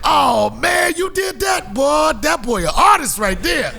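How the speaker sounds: loud, high praise; impressed drug boys. Retold or imitated. Drug boys praising the young artist, loud excited. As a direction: hype him up like a fan. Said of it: "They was like".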